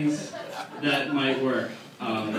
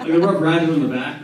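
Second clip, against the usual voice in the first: ordinary talk, nothing marked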